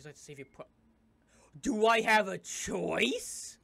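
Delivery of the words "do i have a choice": loud, slow, rising complaint; loud protest. Acted. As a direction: stretch it out indignantly.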